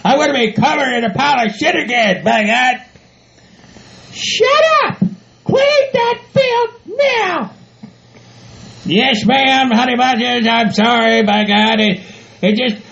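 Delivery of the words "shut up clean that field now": high falsetto, slow drawn-out command; bossy shrill-order partner. Acted. Bride ordering groom to clean the field, bossy. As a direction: order him about in a high put-on voice. Bossy fiancée character scolds him.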